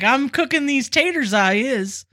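lightly voiced Okie accent. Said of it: "he says, like, things like".

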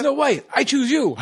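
high, bright "I choose you"; Pokémon trainer call. Acted. Pokémon style call to character.

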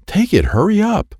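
high-pitched urging; urgent. A narrator's reading. Friend urges Troy to grab the card, urgent. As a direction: press them to move, voice pitched right up.

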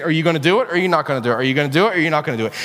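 rapid-fire repeated dare; impatient challenge. Acted. Jonah playing chicken with God, rapid and challenging. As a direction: rattle it off at double speed.

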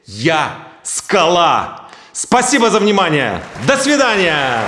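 proud, loud and high finale; slow, proud shout to the hall; triumphant declaration. Acted. Triumphant end of the speech. (громко и торжествующе, с гордостью)

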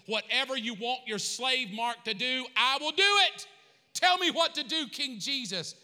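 loud, high preacher's cry; preaching at full force. Real, not acted. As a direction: declare it to the rafters. Sermon climax, crying out.

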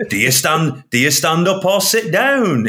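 high, building mock phone-in question; hammed-up breakfast radio patter; Radio One breakfast host. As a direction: rise into a big DJ shout on the last words. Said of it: "That's like a Radio one breakfast show thing".

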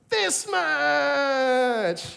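put-on high, loud, stretched child cry; toddler shout. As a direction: yell it like an excited toddler. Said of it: "he does this".

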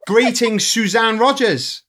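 high, drawn-out grand greeting; energetic hello. Real, not acted. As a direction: welcome the guest with big ceremony. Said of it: "energetic hello as you did".

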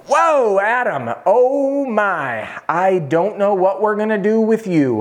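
high, drawn-out mock horror; hammed-up doctor aghast at the sight; alarmed doctor. Acts out alarmed doctor in story.

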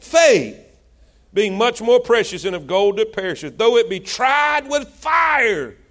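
rising, pounding pulpit preaching; preaching at full force. Acted. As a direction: drive the verse home to the congregation. Preacher builds over scripture.

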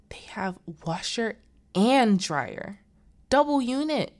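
acted awe, punching the words hard; envious amazement. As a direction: imitate someone gushing with envy. Voicing Margaret marvelling at laundry.

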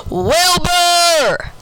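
high, drawn-out holler of the name; acted parental yell, high and long; dad's scream. A narrator's reading. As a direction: scream it like an angry dad, stretching it out. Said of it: "Then my dad screamed".

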